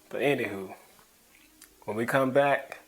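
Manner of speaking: normal talking voice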